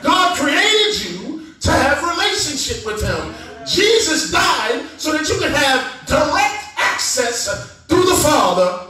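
high, impassioned preaching; full-force preaching. Real, not acted. Preacher telling the congregation God can be talked to, full-force preaching. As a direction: drive it home to the congregation, pitched high.

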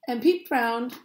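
ordinary delivery

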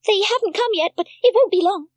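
high, loud cheerful call. A narrator's reading. Heidi calls cheerfully to her grandfather, high and loud. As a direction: call the news up the hill. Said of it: "called out cheerfully".

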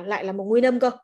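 ordinary talk

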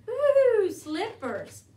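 high, loud gleeful whoop; suddenly brightened. A narrator's reading. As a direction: whoop with delight, then settle onto the word. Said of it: "Then she brightened".

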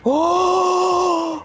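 high startled oh; surprised gasp. Real, not acted. Surprise at glitter.

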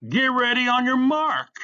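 high, loud sustained starter's shout; called out. A narrator's reading. Starter calling the compromise to racers, shouted call. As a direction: holler the start command down the street. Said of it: "A Bump called".

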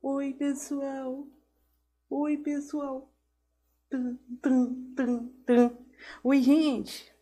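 high, slow little doll voice, close to the mic; high puppet greeting with a heartbeat tum tum; cute doll voice. Pretend. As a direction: squeak it sweetly like a toy up close. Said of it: "Fala assim bem pertinho".

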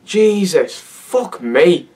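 normal talking voice

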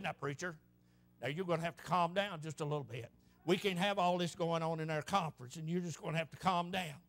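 very low, hushed voice of a stuffy preacher; retold whisper. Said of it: "he whispered to him".

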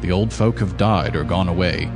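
normal talking voice

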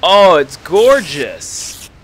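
slow loud admiring exclaim; delighted gasp. Real, not acted. Gamer admiring the finished texture, slow delighted exclaim. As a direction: marvel at it.

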